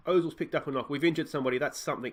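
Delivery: normal talking voice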